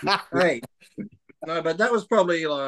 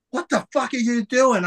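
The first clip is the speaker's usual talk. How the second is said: incredulous demand; 9 semitones higher than usual. Retold or imitated. Wife catches him, startled demand.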